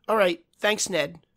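high, hurried thanks; nervous. A narrator's reading. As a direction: cut the call short, scared. Hurried frightened goodbye.

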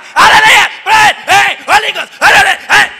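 loud shouting pastor. Retold or imitated. Mocking a noisy pastor shouting hallelujah at a child. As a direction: ham it up like a screaming preacher. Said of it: "when you see pastor".

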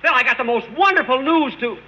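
high-pitched, eager burst; excited calling out. Acted. Caller stops his friend hanging up to share news, excited. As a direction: call him back, pitch leaping up.

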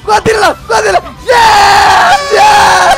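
shouting. Real, not acted. Excited kids' game shout.

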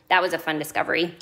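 ordinary delivery

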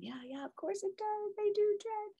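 high, gushing cheer from the group; cheering friends. Retold or imitated. Women in the circle cheer Jen on, high and bright. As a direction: squeal it like friends egging her on. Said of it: "they're all still cheering me on".

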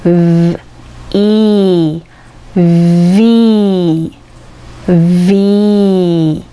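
V said slowly, split into V, E, V sounds and rejoined; Vを音節ごとに区切って発音; English letter V. Shown as a demonstration. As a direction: spell out V syllable by syllable, then say V whole. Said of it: "という三つの音節からできています".

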